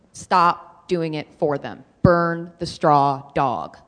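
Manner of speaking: each word slow and punched; slow emphatic. Real, not acted. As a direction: hammer it home slowly. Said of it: "slow emphatic exhortation".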